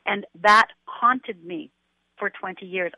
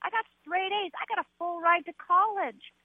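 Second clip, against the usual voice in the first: much higher, chirpy put-on brightness; fake cheerful; 11 semitones higher than usual. Imitating the cheerful front she put on for everyone. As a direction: sell the happy mask, much higher. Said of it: "I was a great fake".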